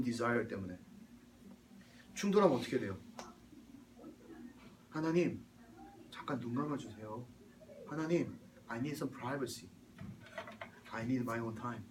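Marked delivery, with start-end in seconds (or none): 4.9-11.8 low, hushed aside to God; soft mock request. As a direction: politely ask for privacy, keeping it soft and low. Acted sly request to God. (조용히 양해를 구하듯)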